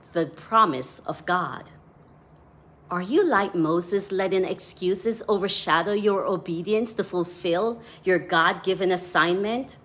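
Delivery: ordinary talk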